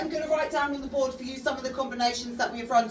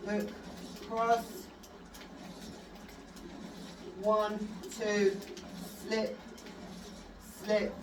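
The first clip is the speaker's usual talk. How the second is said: boxing coach calling combos; 2 semitones lower, 9 dB quieter than usual. Acted. Calls combos while demonstrating.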